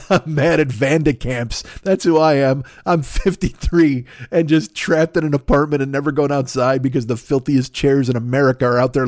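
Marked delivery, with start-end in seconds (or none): none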